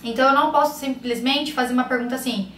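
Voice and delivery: ordinary delivery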